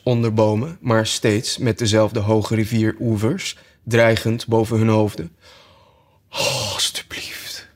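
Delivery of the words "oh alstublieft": soft whispered oh please. Acted. Alice spots bulrushes, soft whispered plea. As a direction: breathe it out in wonder.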